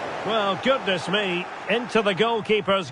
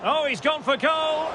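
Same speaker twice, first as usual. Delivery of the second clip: higher-pitched excited commentary; excited shot call; 6 semitones higher than usual. Real, not acted.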